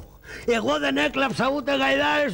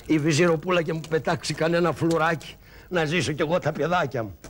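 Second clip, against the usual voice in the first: low, quick aside; low plotting aside; low scheming aside; 6 semitones lower than usual. Acted. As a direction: mutter to yourself low. Aside before crying out.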